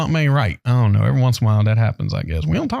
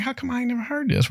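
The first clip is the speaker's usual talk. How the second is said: surprised; 12 semitones higher, 6 dB quieter than usual. Retold or imitated. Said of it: "And I was like".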